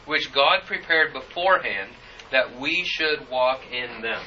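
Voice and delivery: deliberate, forceful pulpit reading; scripture reading. Acted. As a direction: proclaim the verse with weighty emphasis. Reads scripture aloud.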